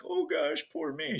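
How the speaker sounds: very high, whiny mock sob voice; mock a self-pitying crybaby; high whiny parody of feeling sorry for herself. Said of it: "She wasn't crying 'cause she was like".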